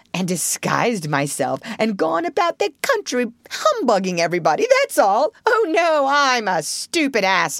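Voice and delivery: animated, swinging high pitch, bragging Toad; boastful Toad. Acted. Toad boasts to Mole of his adventures, boastfully. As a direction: brag away as a swaggering Toad.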